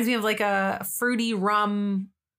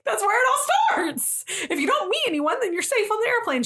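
laughing through it, much higher pitch; laughing high insistence; 12 semitones higher than usual. Retold or imitated. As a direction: insist while cracking up. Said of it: "I was like".

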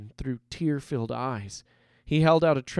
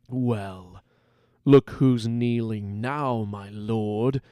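lightly voiced sneering gang leader; malicious gloating; 3 semitones lower, slower than usual. A narrator's reading. Gang leader taunts the captured nobleman, lightly gloating. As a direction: gloat slowly over a beaten lord. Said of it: "flashed with a malicious gleam".

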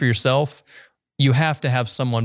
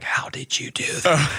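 hushed, amazed whisper; a stunned little whisper of admiration; awed whisper; 3 semitones higher, 4 dB quieter than usual. Retold or imitated. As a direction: breathe it out in disbelief. Amazed aside after calming call.

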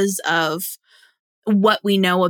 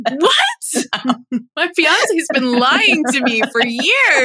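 higher, louder, slowed mock shock; mock outrage; 8 semitones higher, 4 dB louder, much slower than usual. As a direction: play it as a fake betrayal. Joking betrayal after her confession.

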